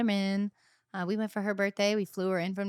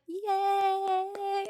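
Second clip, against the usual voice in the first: mock cheer; 12 semitones higher than usual. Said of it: "We were like".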